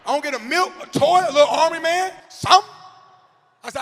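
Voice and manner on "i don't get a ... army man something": high, loud indignant whine; demanding pupil. Acted. Former pupil wanting a treasure-box reward, loud childish complaint. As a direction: protest like a kid owed a prize.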